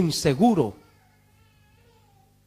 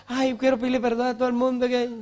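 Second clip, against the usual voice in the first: mock dying plea; 4 semitones higher than usual. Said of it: "cama con cáncer".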